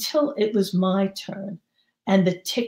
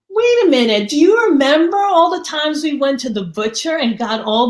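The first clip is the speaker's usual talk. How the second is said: sudden realisation; 7 semitones higher, 6 dB louder, faster than usual. Retold or imitated. Narrator recalls sudden realisation.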